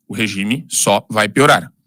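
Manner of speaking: "O regime só vai piorar" bem devagar, palavra por palavra; repetição para fixar. Real, not acted. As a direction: repita mais grave e pausado, destacando "só". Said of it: "preciso repetir algumas coisas pra fixar". (frase lenta e escandida, para gravar na memória)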